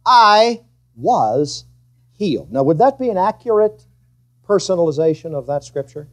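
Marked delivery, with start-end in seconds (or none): 0.0-2.5 slow recitation. Shown as a demonstration. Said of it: "say it with me".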